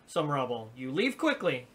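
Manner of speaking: usual speaking voice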